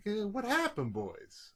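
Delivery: doctor's voice. Retold or imitated. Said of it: "the doctor's like".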